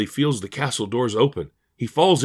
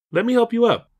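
very high-pitched butler character voice; castle butler; 12 semitones higher than usual. A narrator's reading. Butler offers fallen Elijah help, in high character voice. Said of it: "I'm the castle butler".

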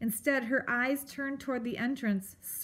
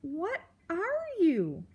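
scared question; 7 semitones higher, slower than usual. A narrator's reading. Scared mouse asks.

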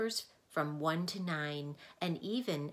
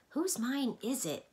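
higher, slower eager question; curious boy Milo; 8 semitones higher, 4 dB louder than usual. A narrator's reading. Milo asks about the mine, curious and higher. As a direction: ask with wide eyes.

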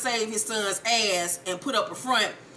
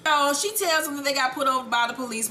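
normal talking voice

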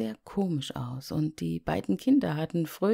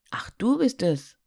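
put-on surprised boy; 8 semitones higher, 5 dB louder than usual. Heinz erkennt den Schneemann.

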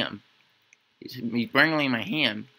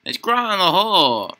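loud, higher burst; shouting; 6 semitones higher, 8 dB louder than usual. Real, not acted. Gamer yelling at a dark cave, loud. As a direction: shout it out eagerly.

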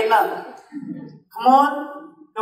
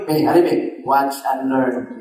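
confident Elijah; 8 semitones lower than usual. Acted. Elijah voiced again, taunting.

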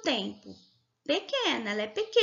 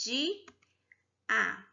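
"Di-a" in two isolated beats; separated syllables; 5 dB quieter than usual. Shown as a demonstration. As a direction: say "Di-a" with a long gap between the syllables. ("Di-a" separado em duas sílabas, com pausa)

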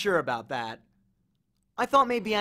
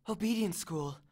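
soft, slightly higher breathless reply; 4 semitones higher, 7 dB quieter than usual. A narrator's reading. Annabeth explains her trick, breathless. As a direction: answer with little breath, softly. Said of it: "she said breathlessly".

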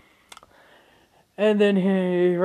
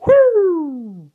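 loud, high whooping siren noise; loud vocal police siren; siren imitation; 13 semitones higher, 11 dB louder than usual. Siren arrives as he thinks.

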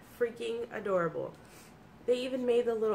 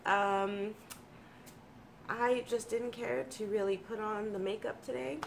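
normal talking voice

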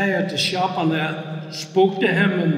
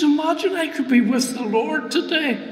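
eager, higher-pitched acted delight; excited wonder; 6 semitones higher than usual. Patient to his wife about being with the Lord, excited wonder. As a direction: imitate yourself thrilled at the prospect.

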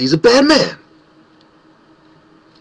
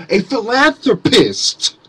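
mock fancy word; 5 semitones higher, slower than usual. Shown as a demonstration. Ranting at Rockefeller, mock-grand "philanthropist". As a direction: savor "philanthropist" with mocking grandeur. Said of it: "That's how I would say it".

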